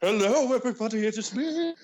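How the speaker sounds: high put-on butler voice; hammed-up villain greeting, climbing in pitch; Ramsey the Butler. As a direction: greet them as a theatrical spooky butler. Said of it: "joined by Ramsey the Butler".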